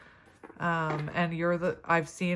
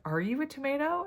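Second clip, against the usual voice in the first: mock-baffled question; 8 semitones higher than usual. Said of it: "I was like".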